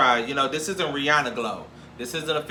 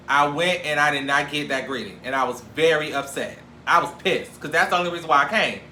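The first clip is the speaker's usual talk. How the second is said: usual speaking voice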